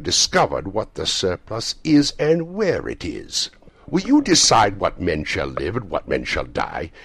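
stern, emphatic reproach with drawn-out phrases; ghost's rebuke. A narrator's reading. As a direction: rebuke him weightily, rising in force. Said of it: "the ghost's rebuke".

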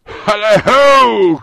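long, high, booming holler; drawn-out hearty call across the room; jovial cry. A narrator's reading. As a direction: cry out with gusto. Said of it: "cried old Fezziwig".

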